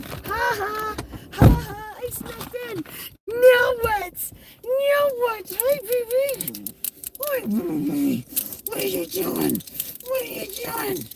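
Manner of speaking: very high, cooing puppy talk. Real, not acted.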